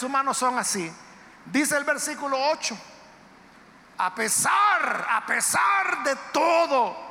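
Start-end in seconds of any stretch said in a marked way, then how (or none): 4.0-7.0 slow, loud, high, stretching "pesar"; emphatic preaching. Acted. Preacher proclaiming verse to congregation: slow emphatic shout.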